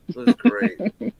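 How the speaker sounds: high and slow, stretching each word; drawn-out high repeat. Pretend.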